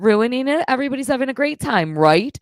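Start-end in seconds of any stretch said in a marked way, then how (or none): 2.0-2.4 sudden loud prodding question; loud rising right? fired at the chat. Real, not acted. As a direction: demand agreement from chat, loudly. Asking chat for agreement.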